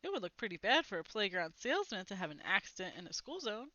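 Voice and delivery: usual speaking voice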